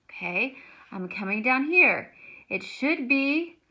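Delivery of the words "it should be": normal talking voice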